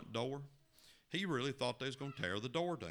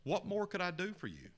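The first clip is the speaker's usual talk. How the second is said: pleading complaint; 6 semitones higher than usual. Acted. Young man complains to Jesus.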